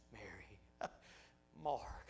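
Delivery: very soft, low, slow whisper; still small voice. Acted. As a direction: whisper the names as a gentle call. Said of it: "small voice".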